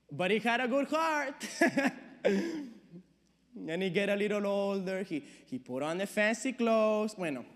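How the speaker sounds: fond immigrant dad, settling down. Acted.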